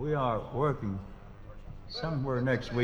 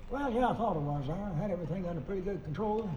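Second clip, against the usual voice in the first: high mountain twang, Ralph's voice pitched up; [name] impression; 9 semitones higher than usual. Singer imitating [name] for the crowd, high twang. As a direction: talk it up high like old Ralph telling a story. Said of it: "Do a little Ralph imitation there".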